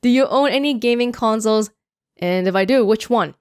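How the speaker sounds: usual speaking voice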